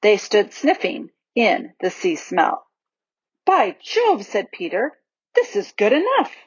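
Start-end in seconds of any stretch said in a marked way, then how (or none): 3.5-4.3 high-pitched burst of delight; delighted exclamation. Acted. Peter delighted by the sea. 5.4-6.3 high, rising, pleased voice; delighted approval. Acted. Peter delighted by the sea.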